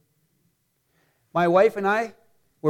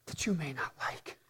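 "that you may not like" in a whisper; whispered aside; 6 semitones lower, 16 dB quieter than usual. Shown as a demonstration. Preacher whispers a wry aside to the congregation. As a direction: lean in and whisper it like a secret.

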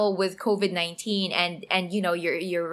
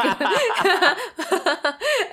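a real, high burst of laughter; amused laugh at her own argument; bright laugh; 9 semitones higher than usual. Real, not acted. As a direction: laugh at yourself.

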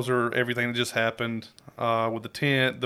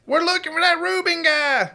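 much higher shouted voice; yelling. Acted. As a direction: holler over the crowd. Yelling across the carnival.

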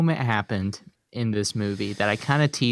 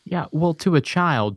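ordinary talk

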